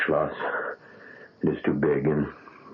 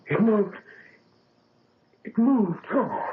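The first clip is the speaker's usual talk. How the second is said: high, breaking with joyful tears; overwhelmed that the hand moves, almost crying; tearful joy; 13 semitones higher, slower than usual. Acted. First movement in grafted hand.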